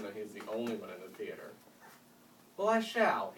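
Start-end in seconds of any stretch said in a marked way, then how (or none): 2.6-3.3 loud, higher, offended proclamation; indignant declaration. Acted. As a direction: declare it with wounded pride. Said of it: "he declared".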